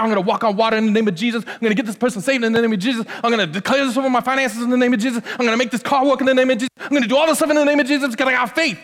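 rapid-fire, high-pitched declarations; mock zealous claiming. Believer claiming miracles in Jesus' name, rapid-fire mock zeal. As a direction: rattle off the claims at breakneck speed, parodying zeal.

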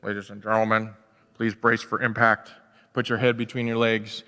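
pilot on the intercom. Retold or imitated. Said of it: "and says".

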